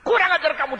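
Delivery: high, sharp angry cry; furious rebuke of the painter; angry outburst. A narrator's reading. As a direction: scold him in sudden anger. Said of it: "Orang itu marah sekali".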